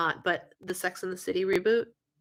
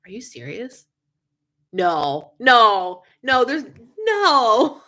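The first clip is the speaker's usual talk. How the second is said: retold disbelief; 7 dB louder, much slower than usual. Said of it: "I'm like".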